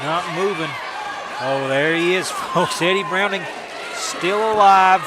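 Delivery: live wrestling play-by-play, rising to shouts at the finish; wrestling commentator. Acted. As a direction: call the match as it happens.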